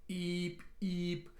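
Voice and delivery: high, soft, steady beeps; imitated alarm. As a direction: mimic a distant alarm beeping. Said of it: "alarms going off the background with an eep".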